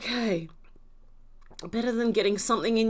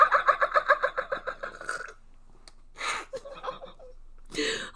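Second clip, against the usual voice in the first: high, loud, tearful laughter; real hysterics, words pitched up high; cracking up; 12 semitones higher, 5 dB louder than usual. Real, not acted. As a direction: talk through a snorting laughing fit. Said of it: "but I'm cracking up".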